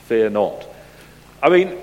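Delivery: ordinary talk, nothing marked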